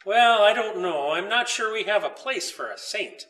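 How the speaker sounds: ordinary delivery, nothing marked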